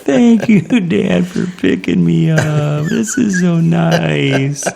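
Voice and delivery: high, loud, slow drugged gushing; groggy daughter voice. Retold or imitated. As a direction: gush like a dopey daughter after surgery. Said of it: "she's just going".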